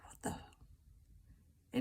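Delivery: a breathless, baffled whisper; stunned whisper. Retold or imitated. To herself on finding the lost handset, a whisper. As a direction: hush it out as if you can't believe your eyes.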